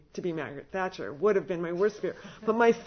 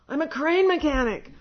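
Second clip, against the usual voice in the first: "I'm a crane mechanic" said higher and much louder; loud protest; 8 semitones higher, 9 dB louder than usual. Retold or imitated. Retold plea to residents.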